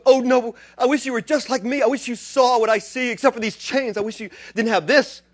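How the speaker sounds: high, loud fervent wish; Paul pleading. Retold or imitated. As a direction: pour it out like Paul before the king. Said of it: "He goes".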